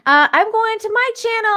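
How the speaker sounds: high, loud excited plug. Real, not acted. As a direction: announce it with excitement. Excited plug for her show.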